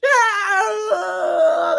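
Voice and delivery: high, loud hammed-up scream of agony; mock scream. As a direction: scream like caught in the transporter. Transporter victims screaming.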